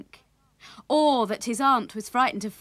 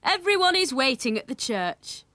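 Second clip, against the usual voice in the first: raised, higher-pitched call; a father's storybook call to hurry; father crying out; 5 semitones higher, 5 dB louder than usual. A narrator's reading. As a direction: hurry them along with a raised voice. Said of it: "cried Willie's father".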